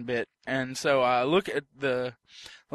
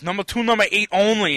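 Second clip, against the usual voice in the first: raised, higher-pitched order-taker voice; getting louder; 6 semitones higher, 5 dB louder than usual. Retold or imitated. Drive-thru worker repeating himself to customers, getting louder. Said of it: "starts getting a little bit louder with it".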